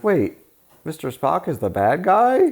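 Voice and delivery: put-on high-pitched childlike disbelief; confused child. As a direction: ask it like a bewildered kid. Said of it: "And I was like".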